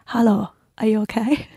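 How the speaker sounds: hushed, hesitant mock Qatari accent; put-on Qatari accent. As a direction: gently imitate a Qatari woman's accent. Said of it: "what accent was that?".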